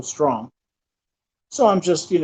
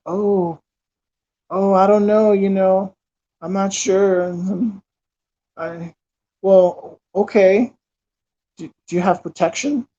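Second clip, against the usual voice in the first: put-on lightly raised, hesitant girlish voice; a hint of a shy, unsure young woman; coy girl voice; 4 semitones higher than usual. Man mimics the hesitant girl he brought home, coy and unsure. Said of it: "She's kinda playing hard to get".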